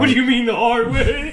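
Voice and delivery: high, very loud disbelief; shrieked, amused demand to explain; incredulous outburst. Real, not acted. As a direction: pounce on the admission with a loud question. Reacting to friend's surprising admission.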